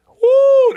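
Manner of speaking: high loud whoop. Real, not acted. Host reacting to a blowout, high loud whoop. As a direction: let out a long, high "woo".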